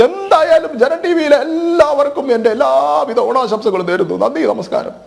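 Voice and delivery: put-on high-pitched mother lamenting her absent children; Mallika Sukumaran impression. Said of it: "മല്ലികാമ്മയുടെ ഞാനൊന്ന് വേണമെങ്കിൽ ഒന്ന് try ചെയ്യാം".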